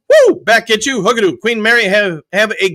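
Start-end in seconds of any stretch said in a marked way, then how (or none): 0.1-0.4 sudden high, loud whoop; excited woo. Acted. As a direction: whoop it out like a fan celebrating. Reading cheerful fan cheer aloud.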